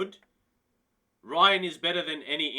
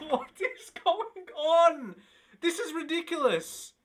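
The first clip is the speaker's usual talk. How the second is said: much higher, laughing through the words; laughing disbelief; 10 semitones higher, 5 dB louder than usual. Real, not acted. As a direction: crack up at how absurd it is. Laughing at absurd release clause.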